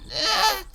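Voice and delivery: high yelp of pain; pained high groan. Acted. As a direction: yelp held back. Pain sound.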